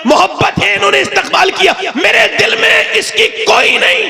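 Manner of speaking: high, driving oratory; fiery preaching. Acted. Preacher praising Imam Ahmad Raza to the crowd, high fiery oratory. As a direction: build a high, stretched-out proclamation to the final name.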